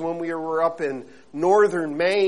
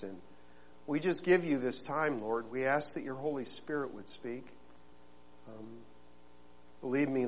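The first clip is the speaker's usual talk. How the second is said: soft, lower prayerful voice; 6 semitones lower, 10 dB quieter than usual. Acted. Pastor praying softly before his sermon. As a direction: pray quietly and humbly before the congregation.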